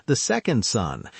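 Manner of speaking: ordinary delivery, nothing marked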